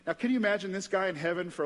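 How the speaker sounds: usual speaking voice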